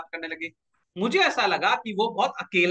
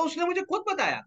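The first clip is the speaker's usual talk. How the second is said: put-on much higher, thin young girl's voice; girlish sister voice; 10 semitones higher than usual. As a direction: pipe it up like a proud schoolgirl. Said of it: "बोला".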